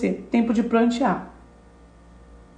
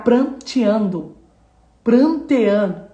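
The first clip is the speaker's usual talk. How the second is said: slowing repetition; slower than usual. Shown as a demonstration. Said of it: "repeats mourning, slowing".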